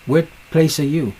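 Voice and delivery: ordinary talk, nothing marked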